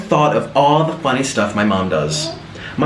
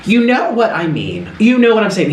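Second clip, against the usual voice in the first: mock-exasperated mom, higher pitch; retold frustration, raised pitch; frustrated mom; 6 semitones higher than usual. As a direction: imitate a mother losing patience. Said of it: "she'll get so frustrated".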